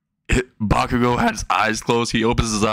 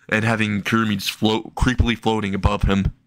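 usual speaking voice

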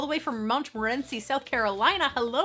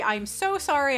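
ordinary delivery

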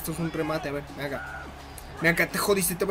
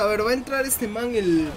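ordinary delivery

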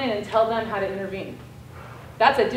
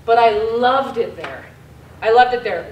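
emphatic loud declaration; 8 dB louder than usual. Real, not acted. Speaker insisting to audience she loved Mauritania, emphatic and loud. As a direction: declare how much you loved it.

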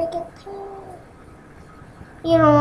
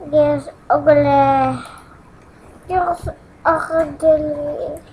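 slow teasing drawl; much slower than usual. Pretend. Drawn-out tease.

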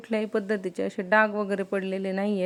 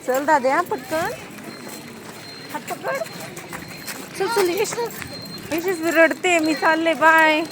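hurrying son along; 8 semitones higher than usual. Real, not acted. Mother hurrying son to school van.

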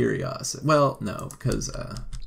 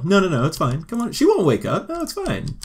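pitched way up, coaxing; high coaxing voice; 7 semitones higher, 3 dB louder than usual. Pretend. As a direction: coax her in a high sing-song. Voicing sims' flirty exchange.

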